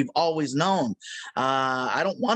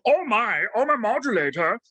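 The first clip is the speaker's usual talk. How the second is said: a light take on the Martian's prim voice; lightly voiced cartoon Martian, fussy; hint of Marvin the Martian; 4 semitones higher than usual. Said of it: "You want, uh, a Marvin the Martian".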